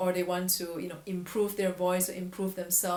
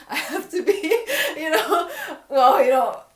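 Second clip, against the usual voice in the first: real laughter breaking through, louder and higher; laughing; 9 semitones higher, 10 dB louder than usual. Real, not acted. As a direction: laugh at yourself mid-sentence. Laughs at own overachieving.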